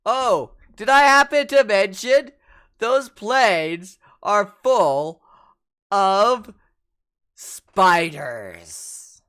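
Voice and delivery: loud, slow, drawn-out reveal; loud teasing reveal. Acted. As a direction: drag out the bad news gleefully.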